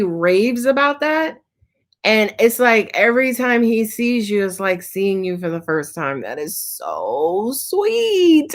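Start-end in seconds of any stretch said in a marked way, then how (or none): 6.2-8.5 drawn-out gushing. Real, not acted. Psychic gushing to a client about her partner, slow and doting. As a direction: stretch "That is so sweet." out, slow and doting.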